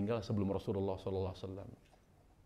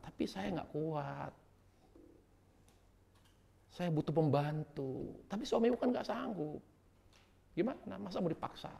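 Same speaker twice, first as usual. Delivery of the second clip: pleading daughter; 7 semitones higher than usual. Retold or imitated. Fatimah complains to her father.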